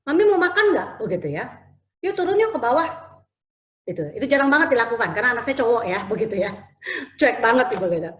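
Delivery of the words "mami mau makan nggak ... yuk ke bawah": son inviting mom. Retold or imitated. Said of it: "terus dia bilang".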